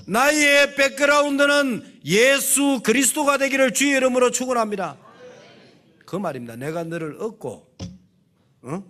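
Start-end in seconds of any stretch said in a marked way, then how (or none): 0.1-5.0 higher, louder climactic blessing; full-force preacher's benediction; preaching blessing. Acted.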